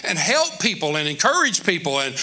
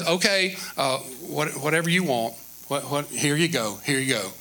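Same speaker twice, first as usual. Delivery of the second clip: lower, softer yielding father; meek dad giving in; 5 semitones lower, 4 dB quieter than usual. Retold or imitated. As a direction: shrug and hand it over. Voicing the passive father.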